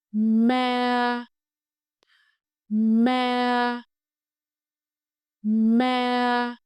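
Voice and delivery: MA held high, flat and long; level tone. Shown as a demonstration. Teacher models for repetition, each MA high and level. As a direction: sustain each MA on one high pitch. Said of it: "Please repeat after me".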